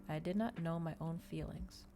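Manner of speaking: usual speaking voice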